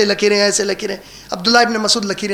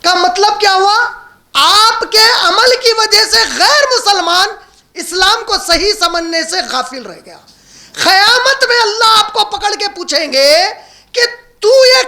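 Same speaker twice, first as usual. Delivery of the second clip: impassioned preaching; 9 semitones higher than usual. Acted. Preacher warns listeners of questioning on Judgement Day, impassioned. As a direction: warn the congregation with rising fervour.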